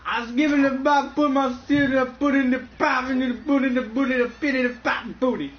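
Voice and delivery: scat like Bill Cosby; Bill Cosby impression.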